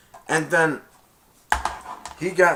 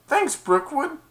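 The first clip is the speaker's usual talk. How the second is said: much higher put-on kid voice; mock thanks; 4 dB louder, slower than usual. Classmates thanking Brookwood in a much higher voice. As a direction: chirp it like a grateful classmate.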